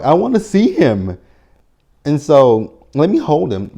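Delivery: high, loud put-on pleading voice; mock whiny Anna. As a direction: beg in a shrill mocking mother voice. Voicing Anna demanding the baby.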